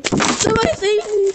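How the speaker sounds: loud, high cry for help; desperate shout for help. Real, not acted. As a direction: yell it out desperately. Louder repeat of plea.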